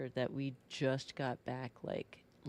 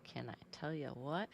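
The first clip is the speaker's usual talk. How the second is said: usual speaking voice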